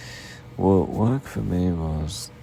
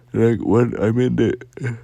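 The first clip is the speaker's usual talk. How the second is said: yawning through words; 6 semitones higher, 9 dB louder than usual. Real, not acted. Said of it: "I can't stop yawning".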